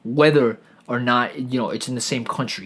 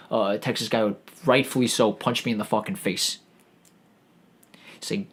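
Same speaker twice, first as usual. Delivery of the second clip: normal talking voice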